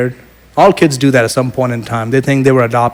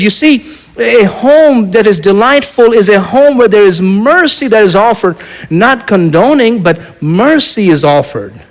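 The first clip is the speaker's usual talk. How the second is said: preaching at full force; 8 semitones higher than usual. Acted. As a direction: drive home the lesson about mercy. Climax of the sermon point.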